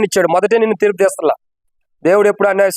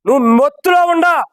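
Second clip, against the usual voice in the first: threatening shout; 6 semitones higher than usual. Acted. Preacher voices stinging locust.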